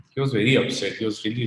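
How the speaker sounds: ordinary talk, nothing marked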